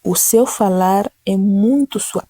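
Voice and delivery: slow, even and gentle; slow gentle repeat. Shown as a demonstration.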